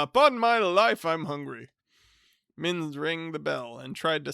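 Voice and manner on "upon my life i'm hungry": loud, high hearty greeting; top of his voice. Acted. Hearty cousin greeting the bachelor at the top of his voice. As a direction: boom it out like a jovial bore. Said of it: "spoke at the top of his voice".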